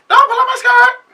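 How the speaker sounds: high woman's voice. Retold or imitated. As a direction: mimic her way up high and loud.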